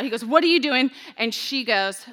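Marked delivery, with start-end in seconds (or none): 0.2-0.9 mock alarmed, louder and higher; freaked-out question. Boaz startled by Ruth at his feet, mock alarmed. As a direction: Boaz caught off guard, half-acted. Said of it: "he freaks out".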